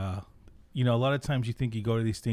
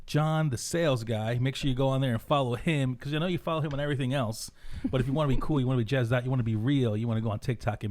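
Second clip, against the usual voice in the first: hype announcer intro; 4 semitones higher than usual. Acted. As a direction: pump up the crowd like an announcer. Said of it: "Good intro for you guys?".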